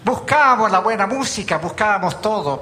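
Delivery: lively preacher's banter, voice lifted; excited joking. Real, not acted. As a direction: ham up the penguin joke with a grin. Joking about pastoring penguins.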